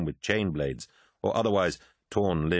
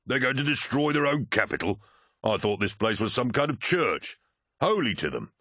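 higher, disbelieving captain's voice; captain questioning the newcomer, lightly voiced disbelief; incredulous warrior captain; 6 semitones higher than usual. A narrator's reading. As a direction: doubt every word he hears. Erlin voiced doubting.

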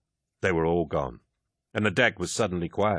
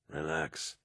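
hushed reassurance; 3 semitones lower, 11 dB quieter than usual. Acted. Aximand coaxing on dark stairs.

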